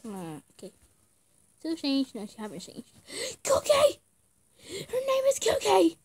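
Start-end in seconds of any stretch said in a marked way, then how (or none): 3.1-6.0 high, excited squeal of a name; excited gasp. Real, not acted. Gamer squealing as she learns the character's name. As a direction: burst out with the name, delighted.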